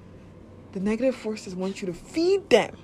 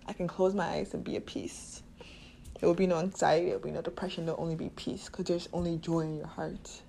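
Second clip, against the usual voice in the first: soft, lower, calming voice; soothing affirmation; 4 semitones lower, 8 dB quieter than usual. Acted. Host soothes podcast listeners in a soft calming voice. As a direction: ease the listener into peace.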